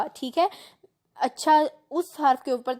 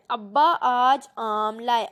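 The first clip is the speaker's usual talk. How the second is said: slow model reading; slower than usual. Shown as a demonstration. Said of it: "آپ کو sentence میں دے دی ہیں".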